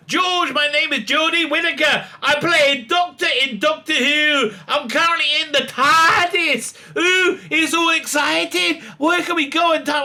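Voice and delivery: high, gushing mock Jodie voice; mock Jodie Whittaker. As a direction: gush like an over-excited presenter. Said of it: "just give her what I did before".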